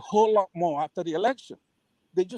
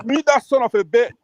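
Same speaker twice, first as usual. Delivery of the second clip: loud angry order, a bit higher; furious demand; 5 semitones higher, 8 dB louder than usual. Real, not acted. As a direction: spit out the insult. Furious demand to mute opponent.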